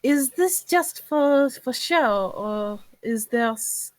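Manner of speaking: slow, halting question with restarts; struggling for words. Acted. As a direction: feel your way through the question. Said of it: "she's struggling to find the words".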